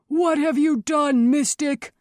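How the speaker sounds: loud, high accusing shout; angry shout. A narrator's reading. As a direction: shout it like an outraged townsman. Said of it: "the nearest one shouted".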